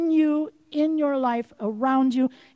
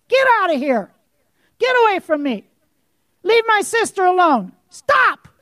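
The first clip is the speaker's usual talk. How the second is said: loud, higher furious command, climbing to a shout on "Stop"; angry rebuke; 6 semitones higher, 7 dB louder than usual. Real, not acted. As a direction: drive the devil off in real anger. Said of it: "Sorry, I am angry".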